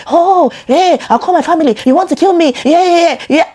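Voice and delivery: an angry partner shouting from across the room; mock shouting partner. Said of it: "begin to shout from there".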